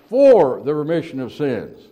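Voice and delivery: loud emphatic "for" leading the phrase; stress on for. Real, not acted. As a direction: hit "for" loud, then ease off. Preacher stresses the disputed word.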